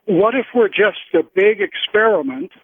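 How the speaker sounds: usual speaking voice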